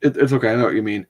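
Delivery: normal talking voice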